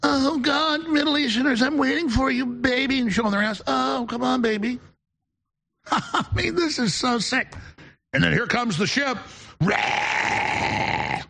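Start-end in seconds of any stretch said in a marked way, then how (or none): 0.0-4.8 mock girl voice. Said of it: "ten-year-old girls going". 5.9-7.5 laughing. Real, not acted. Laughs at his own parody. 9.6-11.2 mock battle roar. The ship arrives, mocking invaders.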